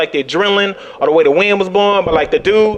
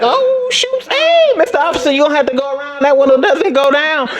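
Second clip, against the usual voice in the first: high, sheepish faux-innocence; nervous high innocent voice; 8 semitones higher than usual. Comic to the angry officer, high sheepish innocence. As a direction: play dumb to the officer.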